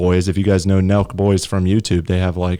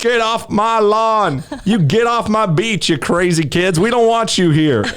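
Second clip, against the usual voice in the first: high, drawn-out mock yelling with a country twang; hammed-up angry complainer chasing off kids; angry Karen; 11 semitones higher, slower than usual. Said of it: "like an angry Karen".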